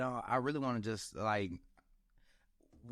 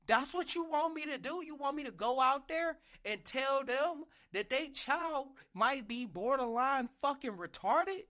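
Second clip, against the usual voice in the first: high-pitched, exasperated dressing-down; scolding rant; 9 semitones higher than usual. Retold or imitated. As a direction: tear into the kid with disbelief, much higher. Scolding the kid in retelling.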